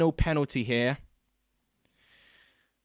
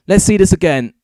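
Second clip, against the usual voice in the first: \"this\" called out louder, voice still buzzing; louder, keyed-up call to rewatch; excited replay call; 3 semitones higher, 8 dB louder, faster than usual. Real, not acted. Excited replay call after goal.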